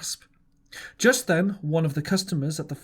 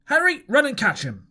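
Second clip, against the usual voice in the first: high, louder shouted commands; shouting orders; 8 semitones higher, 4 dB louder than usual. A narrator's reading. Silver ordering Harry to chase the runaway, shouting. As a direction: bark the order across the room. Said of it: "cried Silver".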